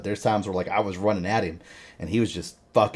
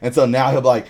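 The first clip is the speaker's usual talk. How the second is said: ordinary delivery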